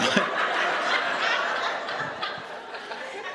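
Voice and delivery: high breathless laugh; real laugh at his own joke. Real, not acted.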